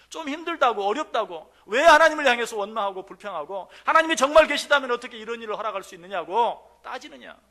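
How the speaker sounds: loud, high impassioned rebuke; fiery rebuke. Acted. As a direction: preach the rebuke with full force. God's rebuke at full force.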